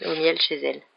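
ordinary delivery

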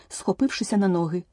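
ordinary delivery

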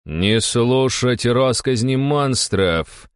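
very slow, high, cold command; icy voice. A narrator's reading. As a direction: draw out the order icily. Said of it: "ледяным голосом проговорил лысый". (ледяным голосом)

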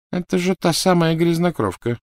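high-pitched woman murmuring with held-in force; undertone. A narrator's reading. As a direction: speak high but contained, as an aside. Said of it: "вполголоса проговорила она". (высоким голосом, вполголоса)

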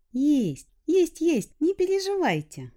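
put-on high beaming girlish reassurance; cheerful girl voice. A narrator's reading. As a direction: sing it out brightly, smiling. Girl beams with smile.

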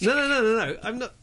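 high, quick string of refusals; urgent objection. Real, not acted. Co-host is about to give away the door, urgent objection. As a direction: cut in fast to stop a guess. Hushing a co-host's spoiler guess.

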